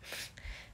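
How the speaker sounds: soft breathy laugh; short laugh. Acted. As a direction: laugh quietly at him. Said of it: "Remus began to laugh".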